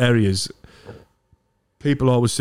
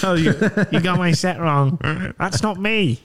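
higher, put-on prim voice; mock posh voice; 6 semitones higher than usual. Comic showing how he'd talk off stage, prim. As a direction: play a prissy version of yourself. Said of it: "I might start talking like this".

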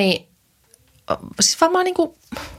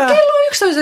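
surprised; 3 dB louder, faster than usual. Real, not acted. Startled by the time.

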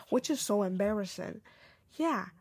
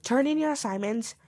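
ordinary talk

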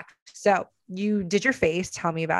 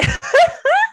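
loud, very high burst of laughter; shrieking laugh; 9 dB louder than usual. Real, not acted. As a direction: cackle helplessly. Laughing at friend's story.

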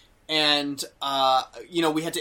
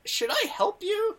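imitating a confused old woman; slower than usual. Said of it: "like, confused".

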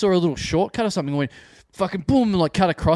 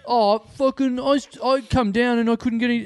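complaining punter; 7 semitones higher than usual. Retold or imitated.

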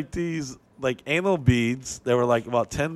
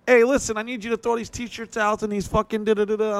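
high, bossy little older woman, with a hint of an accent; pushy club promoter barking orders, pitched way up; Italian little lady; 10 semitones higher than usual. Older Italian promoter bossing the comic, high and pushy. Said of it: "She's like".

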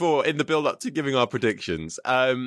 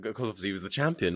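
normal talking voice

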